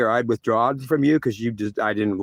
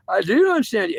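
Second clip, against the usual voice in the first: higher, small voice answering God; own meek reply; 7 semitones higher than usual. Pretend. Himself answering God, in a small higher voice. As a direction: answer him meekly, voice up high.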